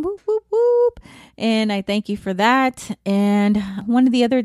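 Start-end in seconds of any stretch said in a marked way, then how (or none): 0.0-1.0 high cheering whoop, whoop, whoop; excited whoop. Real, not acted. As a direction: celebrate the plays with a high cheer. Celebrating play count.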